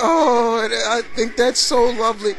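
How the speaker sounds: mock tearful fangirl; friend teary. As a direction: imitate a weepy friend gushing. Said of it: "And she's like".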